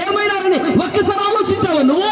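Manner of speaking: impassioned sermon questions; preaching. Acted. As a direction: press the question harder each time. Challenging the rich man.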